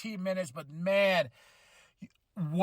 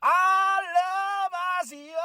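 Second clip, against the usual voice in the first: high, slow, loud wailing cry; crying shout of love; 11 semitones higher, 4 dB louder, slower than usual. Real, not acted. Fan crying out his love for the tag team, wailing shout. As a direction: howl it out like an overcome fan. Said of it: "I need to do something".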